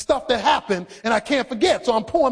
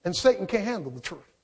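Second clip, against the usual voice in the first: much softer, lower echo of the line; quiet aside; 4 semitones lower, 6 dB quieter than usual. Acted. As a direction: let the line drop away to a hush. Quiet turn after movie quote.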